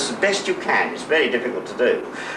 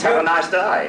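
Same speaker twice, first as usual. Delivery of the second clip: Australian accent; 5 semitones higher than usual. Shown as a demonstration. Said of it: "but in your case".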